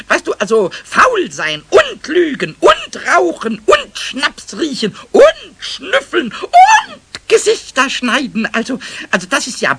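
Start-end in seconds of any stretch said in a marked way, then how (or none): 0.3-8.5 langsam, jedes Laster betont; zählt die Sünden fassungslos auf; empörte Aufzählung. Acted. Pumuckl zählt Stefans Sünden auf. (Pumuckl zählt empört Stefans Sünden auf, langsam)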